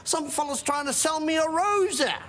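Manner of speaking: high-pitched, worked-up storytelling; hammed-up indignant grumbling; exasperated comic rant. Comic rant about the fancy restaurant.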